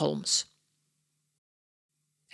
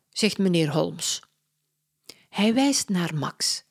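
ordinary delivery

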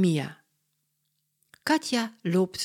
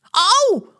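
much higher, louder yelp; ouch cry; 9 dB louder than usual. Acted. The mean man cries out after a slap, pained. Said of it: "roept hij".

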